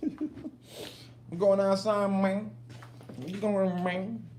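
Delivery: high, drawn-out talk to a dog; playful pet talk. Pretend. Owner teasing dog about going outside, high playful voice.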